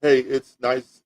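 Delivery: ordinary delivery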